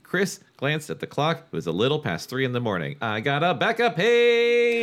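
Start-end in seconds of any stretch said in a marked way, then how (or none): 3.0-4.8 high, loud, drawn-out wail; mock dramatic call. Reader hamming up a backup page, mock wail.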